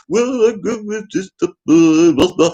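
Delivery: posh plummy voice. Retold or imitated. Mocking posh singers, a high plummy voice. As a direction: put on a posh crooner's voice. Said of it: "plummy people speaking like that".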